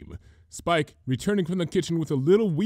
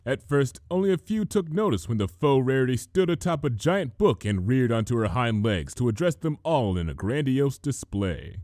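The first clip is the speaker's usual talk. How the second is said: usual speaking voice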